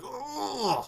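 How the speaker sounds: high, drawn-out wailing "oh"; retold teary wail; mock bawling. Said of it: "she's bawling".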